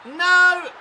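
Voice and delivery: very high, loud cry of dismay; high-pitched desperate yell; real alarmed shout. Real, not acted. Reacting to a goal chance.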